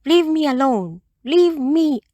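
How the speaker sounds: high cross bird voice, leave me alone; cross little bird. A narrator's reading. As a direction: shoo the pest away in a high chirpy voice. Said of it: "crossly saying".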